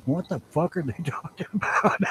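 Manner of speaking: puzzled audience voiced through a laugh; laughing mock bewilderment. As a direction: break into laughter mid-question.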